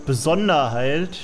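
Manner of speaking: normal talking voice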